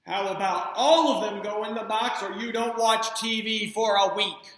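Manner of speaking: loud, firm mother laying down the law; stern ultimatum. Acted. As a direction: lay down the law, loudly.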